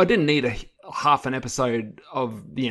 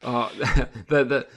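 normal talking voice

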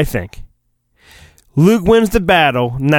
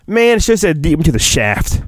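normal talking voice

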